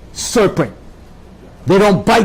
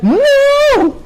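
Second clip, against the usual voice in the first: emphatic no. Retold or imitated. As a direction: throw the no out high and hard. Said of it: "she put the emphasis and".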